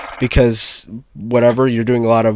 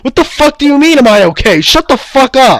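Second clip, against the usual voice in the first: higher, exasperated mock yell; retold angry outburst; 11 semitones higher, 4 dB louder than usual. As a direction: blast the kid for asking. Angry reply to the kid.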